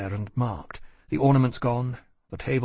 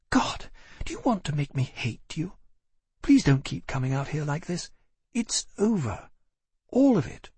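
exasperated; 4 semitones higher than usual. A narrator's reading. Caroline pushes him away.

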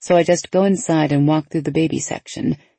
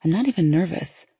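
ordinary delivery, nothing marked

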